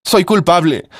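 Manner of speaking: high, raw cry of guilt; anguished cry. Acted. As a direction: cry it out from a torn heart. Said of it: "gritaba Sara con el alma desgarrada".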